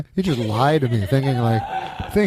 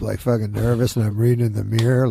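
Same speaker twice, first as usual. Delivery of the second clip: ordinary talk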